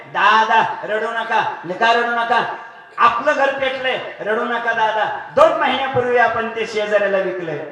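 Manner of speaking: loud, high reassurance; son reassuring. Acted. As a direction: tell father not to cry, loudly. Middle son reassures father loudly. (वडिलांना मोठ्याने समजावणारा मुलगा)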